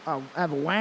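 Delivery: light imitation of an Australian accent; a soft, lightly done Australian accent; Australian accent, only hinted. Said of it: "Australians might go".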